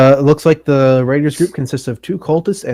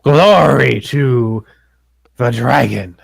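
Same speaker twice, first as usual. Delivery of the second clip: slow, drawn-out battle cry, only mildly raised; cultist's mock scream; 3 dB louder, much slower than usual. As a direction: stretch it out like a chanting zealot. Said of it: "he'll basically scream".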